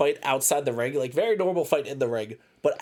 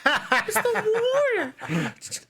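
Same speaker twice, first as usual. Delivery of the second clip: much higher, slow mock wail; mocking the Warrior call; 10 semitones higher, slower than usual. Friend teasing about the DM, high mock wail. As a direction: tease him with her ringside cry. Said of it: "I was like".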